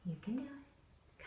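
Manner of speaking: soft whispered encouragement; a tiny hushed you can do it; encouraging whisper. Shown as a demonstration. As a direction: coax them gently under your breath. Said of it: "kinda grin, little grin, little smile".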